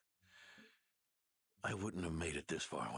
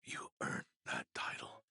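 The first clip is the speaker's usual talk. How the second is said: fading whisper; 5 semitones lower than usual. Acted. The dying knight reassures the young medic, fading whisper. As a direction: let the words barely escape.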